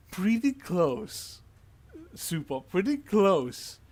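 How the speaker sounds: suspenseful scene-setting, stretched words; dramatic reveal. Acted. Game master reveals a sudden creature attack.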